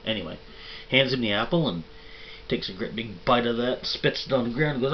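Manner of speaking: usual speaking voice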